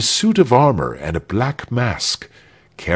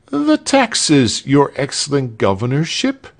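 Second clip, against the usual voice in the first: high, raised petitioner voice; petitioner before the governor; 7 semitones higher, 3 dB louder than usual. A narrator's reading. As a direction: plead up high to a ruler.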